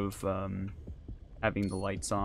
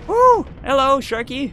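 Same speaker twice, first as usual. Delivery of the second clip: excited whoop; 12 dB louder, slower than usual. Real, not acted. Greeting a shark in game.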